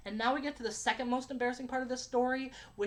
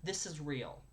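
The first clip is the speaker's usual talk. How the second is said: ordinary talk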